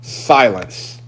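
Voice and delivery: ordinary talk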